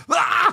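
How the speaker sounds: high, loud burst of fright; exaggerated shocked yell; mock startled scream. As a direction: scream as if someone jumped out of the grass, for laughs. Imitating startled man in the film.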